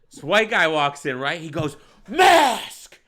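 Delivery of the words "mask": high, loud mock shout; retold shout. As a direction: bellow it like an outraged bystander. Acted stranger confronting a customer.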